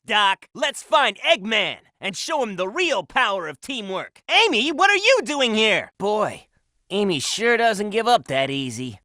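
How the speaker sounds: ordinary delivery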